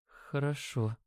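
very soft, faint reply; barely audible. A narrator's reading. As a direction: agree in a tiny hushed voice. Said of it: "Её голос был едва слышен". (едва слышно)

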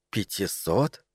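soft, low echoed question; hushed disbelief. A narrator's reading. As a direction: repeat it softly in disbelief. Wife echoes the sum, taken aback.

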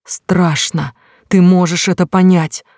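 screaming in panic; hysterical yell. Acted. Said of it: "вопя громче".

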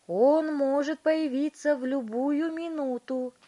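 high, loud excited announcement; shouting. A narrator's reading. Wonka alerting the parents watching the screen, shouting. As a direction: call it out urgently. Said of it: "крикнул мистер Вонка".

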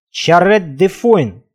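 slow, distinct French words; surprisingly clear. Acted. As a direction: articulate each word slowly. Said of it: "неожиданно внятно сказал". (неожиданно внятно, медленно)

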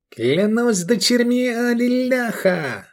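very high, drawn-out cry; exultant cry. A narrator's reading. Sheriff swears by goddesses at good news, crying out. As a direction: cry out the oath slowly, voice pitched right up. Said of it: "вскричал Рахаль".

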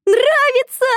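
very high, loud cry of joy; delighted squeal. A narrator's reading. Shusha sees herself crowned, squealing with joy. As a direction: squeal at the mirror.

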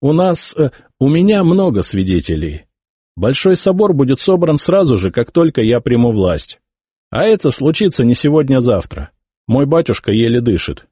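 lightly voiced scornful heir; arrogant young emperor. A narrator's reading. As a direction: order the old bishop away with disdain. Nephew voiced, gloating.

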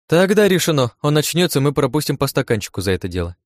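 high, loud start settling down; happily seal the plan; joyful announcement. A narrator's reading. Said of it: "радостно произнесла она".

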